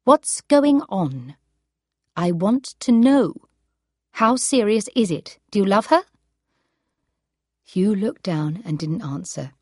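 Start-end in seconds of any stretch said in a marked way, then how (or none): 0.1-6.0 ice-cold voice. Acted. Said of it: "said Kate in an ice-cold voice".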